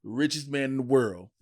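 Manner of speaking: ordinary talk, nothing marked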